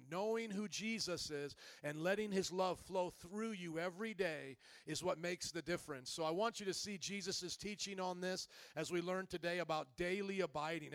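normal talking voice

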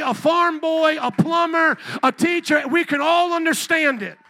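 loud, high, insistent sermon; fiery preaching. Acted. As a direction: build it up and hammer each point home. Preaching at full force.

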